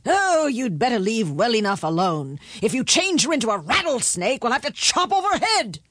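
exasperated king, rising in pitch; hasty, alarmed king. A narrator's reading. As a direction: cut in urgently as the king. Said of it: "the king said quickly".

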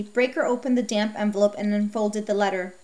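ordinary delivery, nothing marked

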